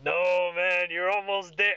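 put-on boisterous bar drunk, voice pushed up; Craig. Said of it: "and Craig goes".

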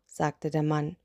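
ordinary delivery, nothing marked

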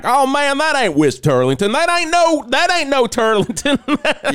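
mock-protesting fan; incredulous listener. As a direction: act out a doubting listener, swooping up on the objections. Acting out a sceptical listener.

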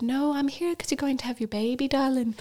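gently reassuring Irish midwife; Irish accent. Retold or imitated. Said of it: "she had an Irish accent".

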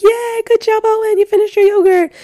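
put-on very high, loud cheering for a baby; excited mom cheer. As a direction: squeal your praise like a proud mom. Cheering on her baby by phone.

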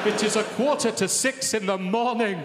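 high, loud exasperated outburst. Retold or imitated. Father finding loo brush on walls, exasperated loud cry. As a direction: despair at the mess, loudly.

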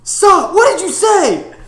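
loud, high-pitched, drawn-out disbelief; mock outraged shout. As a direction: imitate someone yelling in appalled disbelief. Retold outraged reaction to bad accent.

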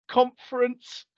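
put-on timid, high little-boy voice; boyish voice. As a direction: answer like a scared schoolboy. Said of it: "sort of really intimidated, like, boyish voice".

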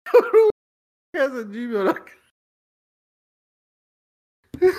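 squealed high and fast through laughter; laughing. Real, not acted. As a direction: spill it out cracking up. Laughing through the fake address.

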